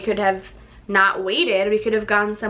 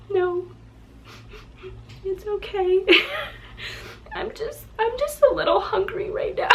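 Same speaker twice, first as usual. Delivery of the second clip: higher, wobbly holding back tears; forcing okay through tears, pitched up; teary brave face; 10 semitones higher, 4 dB quieter than usual. Retold or imitated. Pregnant wife reassuring her husband while crying, high and teary. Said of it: "And I'm like".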